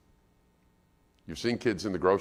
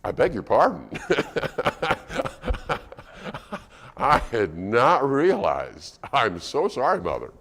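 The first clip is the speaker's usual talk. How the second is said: overly proper butler-like apology; mock polite child; 3 semitones higher than usual. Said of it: "Have you ever seen the child go".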